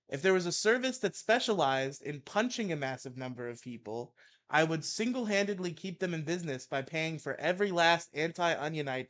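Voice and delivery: heated mock-angry reading; ranting article read. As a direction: read it like a furious rant. Reads Maddox rant aloud.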